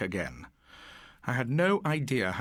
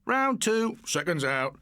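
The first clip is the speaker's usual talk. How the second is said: mock announcer shout, higher; 5 semitones higher, slower than usual. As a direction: call the round like a ring announcer. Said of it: "there were shouts of".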